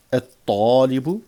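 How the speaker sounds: slow, loud "at-talibu", held emphatic t, no L; doubled first letter. Shown as a demonstration. Teacher drilling sun-letter words, doubled emphatic t. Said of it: "on va doubler".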